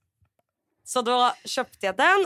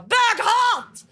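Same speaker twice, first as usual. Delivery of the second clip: much higher, louder mock scream; screamed insult; 12 semitones higher, 5 dB louder than usual. Wife shrieking at her partner who refuses, screamed. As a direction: yell it at him in a fit. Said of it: "då skriker jag bara".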